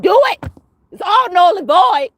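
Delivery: high, loud challenge to her rivals; defiant shout. Real, not acted. Defiant shout at the rivals doing rituals. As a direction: yell it at the ritual-doers, full force.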